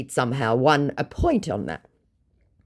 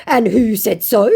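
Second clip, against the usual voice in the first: offended; 6 semitones higher, 6 dB louder than usual. Retold or imitated. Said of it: "Completely offended".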